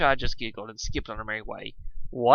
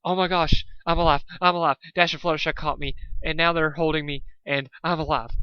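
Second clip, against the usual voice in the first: high, loud yelps of relief; breathless overjoyed rambling; relieved elation; 5 semitones higher, 4 dB louder than usual. A narrator's reading. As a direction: gasp out that you survived. Survives fall, caught by friends.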